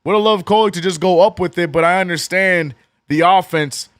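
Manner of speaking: high, loud broadcast-style call; live play-by-play. Acted. Streamer calling the Knicks comeback for chat, high and loud. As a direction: narrate each possession like a TV announcer.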